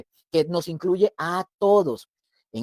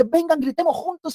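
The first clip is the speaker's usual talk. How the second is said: higher, eager "come on, let's shout together"; excited invitation; 9 semitones higher than usual. Retold or imitated. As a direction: call friends over to celebrate. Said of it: "Corre hacia ellos y va así".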